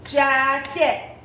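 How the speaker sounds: "jacket" split into two beats, jack-et; teacher separating both halves of "jacket"; clapped-out syllables. Shown as a demonstration. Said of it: "we're gonna clap it out".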